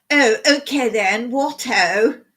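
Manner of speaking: exaggerated old-fashioned upper-class English accent; hammed-up high-class English accent; mock high-class English. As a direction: parody an ancient English toff accent. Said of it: "Like some ancient high-class person".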